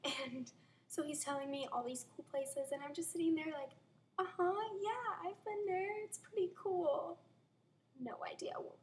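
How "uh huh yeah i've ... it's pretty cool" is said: high, drawn-out mock agreement; put-on casual bluff, pitched up. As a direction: play along, pretending you know. Bluffing along in retold conversation.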